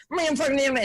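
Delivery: high, garbled mimicking grumble; mock tweaker rant. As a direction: babble it back high like a ranting tweaker. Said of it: "this motherfucker goes on a thirty-second thing".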